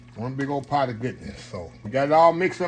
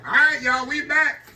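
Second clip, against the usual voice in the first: excited welcome back; 10 semitones higher, 4 dB louder than usual. Real, not acted. Host returns from break.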